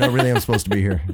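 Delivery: rapid-fire protest in an everyday voice; fast, normal voice. Retold or imitated. Insisting to security he belongs there, really fast. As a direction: hurry it out, act dropped. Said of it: "really fast with the normal voice".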